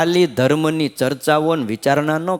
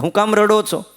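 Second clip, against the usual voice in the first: wife's scolding retort; 7 semitones higher than usual. Retold or imitated. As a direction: scold the weeping husband, half laughing at him. Dying wife scolds crying husband. (પતિને ઠપકો આપતી પત્ની, ઊંચા અવાજે)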